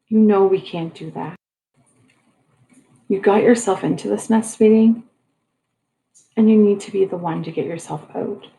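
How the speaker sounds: usual speaking voice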